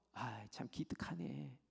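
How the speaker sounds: soft, slow praise; quietly impressed, unhurried; tender approval. Acted. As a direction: murmur it like a fond, pleased parent. God pleased with Abraham's intercession.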